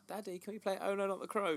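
put-on high, quick, eager child asking; little girl voice. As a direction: plead like an excited toddler. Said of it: "she's like".

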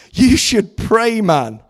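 high, loud, drawn-out insistence; aghast exhortation. A narrator's reading. Sisters urging the minister to pray, loudly aghast. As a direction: tell him as if it were obvious, aghast. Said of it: "they gasped".

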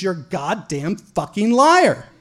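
barked accusation. Retold or imitated. Angry officer doubts suspect's hometown, imitated bark. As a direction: act out the officer's bark, leaning on each word. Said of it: "every single time he barks at me".

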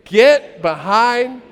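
loud, long-drawn group chant; big rallying shout, each word stretched; with gusto. Shown as a demonstration. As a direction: belt it out slowly for a crowd to join. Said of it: "You gotta say it with gusto".

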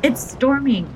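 excited cry. A narrator's reading. Older boy cries out to everyone about the storm, excited. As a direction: blurt it out, thrilled and alarmed. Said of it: "the older boy cried".

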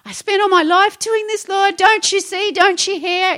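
hammed-up self-pitying protest to the Lord; hissy fit. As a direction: whine at God like a wounded martyr, high and loud. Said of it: "get myself in a little hissy fit and go".

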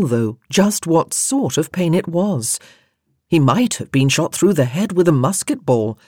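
plain reading voice

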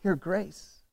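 very soft, grateful prayer; hushed worship. Acted. Preacher acting a grateful worshipper speaking to God, hushed. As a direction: drop to a near-hush, reverently. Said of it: "just a moment of worship".

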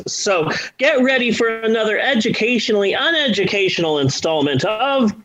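ordinary talk

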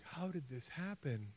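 very soft, slow wonder; quiet, stretched-out acted puzzlement; hushed bafflement. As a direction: drop right down and puzzle it out slowly. Disciples still baffled.

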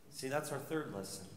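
ordinary delivery

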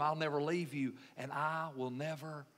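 low, soft, solemn assurance; solemn promise. Acted. Preacher voices Jesus promising his disciples, solemn promise. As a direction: let it sink low and soft.

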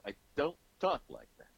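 high, emphatic mock indignation; indignant protest. Guest retells denying he talks that way, mock indignant.

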